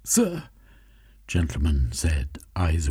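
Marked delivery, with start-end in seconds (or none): 0.0-0.4 much higher, far-off servant voice. Acted. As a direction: call out warily into the dark.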